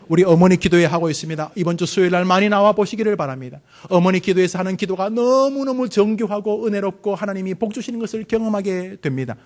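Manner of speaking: ordinary talk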